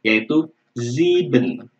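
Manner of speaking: sieben with a z sound, slow; German s as z. Shown as a demonstration. Teacher shows the S read as Z, slow and careful. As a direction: sieben said zee-ben, drawn out. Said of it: "melafalkannya seperti Z ya".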